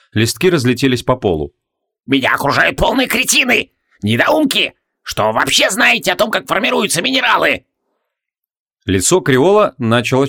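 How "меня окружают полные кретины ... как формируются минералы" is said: put-on high, cranky old man's tirade; ranting. A narrator's reading. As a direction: rail at the fools in a high voice. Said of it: "поминутно срываясь на крик".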